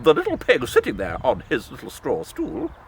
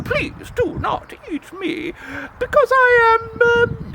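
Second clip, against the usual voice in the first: high, pleading piglet voice; high little pig; 10 semitones higher than usual. Acted. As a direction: beg the wolf in a small high voice.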